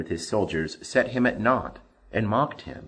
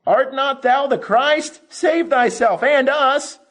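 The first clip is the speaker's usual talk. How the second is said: much higher, loud, slow railing voice; 7 dB louder than usual. A narrator's reading. Condemned criminal railing at Jesus beside him, loud. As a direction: rail at him in bitter scorn. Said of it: "railed on him".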